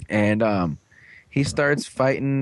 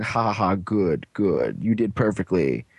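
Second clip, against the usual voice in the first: low, dropping cartoon villain voice; hammed-up satisfaction, sinking deep; mock gloating villain; 4 semitones lower than usual. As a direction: gloat with a put-on evil laugh. Hidden villain gloating, acted out.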